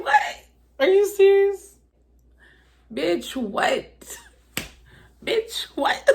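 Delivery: high, incredulous repeated bitch what; outraged disbelief. Real, not acted. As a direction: squeal it in stunned disbelief. Reacting to accusing text.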